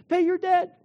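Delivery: high, loud chant of collectors; demanding shout. Retold or imitated. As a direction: bark it like a hounding debt collector. Another collector pops out.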